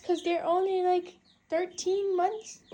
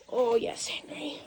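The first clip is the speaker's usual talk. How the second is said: a hushed whispered Henry; whispered name; 5 semitones lower than usual. Real, not acted. Whispered name before shouting.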